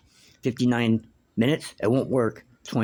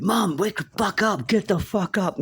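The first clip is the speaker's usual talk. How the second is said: much higher, slower, desperate yell; yelling at mother; 11 semitones higher than usual. Retold or imitated. Son at mother's hospital bed, desperate retold yell. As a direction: shout her awake. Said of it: "I'm like".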